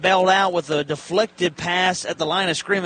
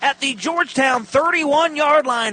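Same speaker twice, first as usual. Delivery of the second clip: excited; 8 semitones higher, 4 dB louder than usual. Real, not acted. Play-by-play after big catch.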